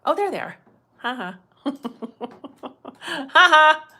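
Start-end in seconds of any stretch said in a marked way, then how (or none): 1.6-3.0 breathy giggle while finding the comments; soft delighted laugh; real laugh. Real, not acted. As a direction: let a short real laugh slip out.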